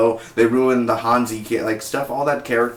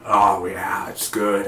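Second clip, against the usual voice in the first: grumbly Clayface voice; 4 semitones lower, slower than usual. Retold or imitated. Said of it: "he has a grumbly voice".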